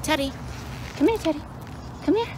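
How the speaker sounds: high sing-song call to the dog; high coaxing call. Real, not acted. Owner calling her dog Teddy in a high coaxing voice.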